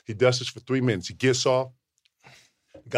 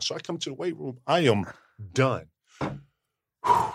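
ordinary talk

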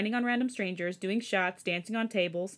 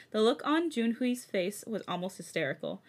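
ordinary delivery

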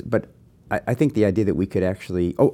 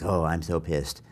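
lightly put-on accent for an inner wolf; mock grumbling as the wolf inside, growing louder; a hint of a comic wolf voice; angry little wolf; 7 dB louder than usual. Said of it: "why the wolf has that accent".

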